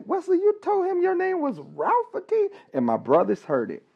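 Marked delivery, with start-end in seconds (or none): 0.0-2.5 high, disbelieving dad voice; incredulous dad. Retold or imitated. Father confronting his son about the misheard name, incredulous. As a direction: act out the stunned father. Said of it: "he's like".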